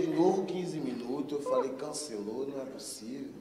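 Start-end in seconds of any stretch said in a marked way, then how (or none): 1.7-3.3 disbelieving mutter. Retold or imitated.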